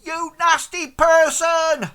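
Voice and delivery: high, loud, drawn-out jeer; heckler's shout. Acted. Someone in the crowd abuses Clarence, high slow shout. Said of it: "somebody shouts out".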